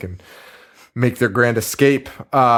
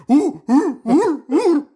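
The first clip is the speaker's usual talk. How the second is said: much higher, louder silly mummy ooh sounds; hooting mummy ooh noises, much higher pitch; dumb mummy noises; 13 semitones higher, 3 dB louder, slower than usual. Retold or imitated. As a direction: moan like a clueless mummy getting shocked. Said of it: "making the dumbest noises".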